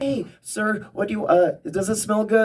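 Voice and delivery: high-pitched mock sales lady, lightly voiced; candle shop lady. Clerk asks the comic if the candle smells good, pitched high.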